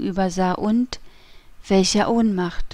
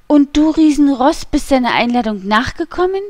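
louder, higher scolding question; indignant disbelief; 5 semitones higher, 6 dB louder than usual. A narrator's reading. Wife to husband who obeyed the Cardinal's summons, indignant.

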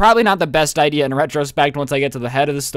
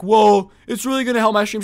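put-on pleading boyfriend; 6 semitones higher, 3 dB louder than usual.

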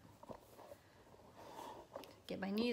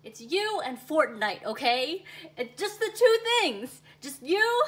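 insistent pleading; 4 semitones higher, 8 dB louder, slower than usual. Real, not acted. Pleading to girlfriend.

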